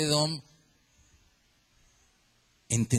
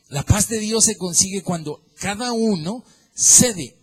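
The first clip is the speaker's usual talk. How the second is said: loud, high fiery preaching; full-force preaching; 4 semitones higher, 5 dB louder than usual. Real, not acted. Pastor exhorting the congregation about peace, loud fiery preaching. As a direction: drive it home to the congregation.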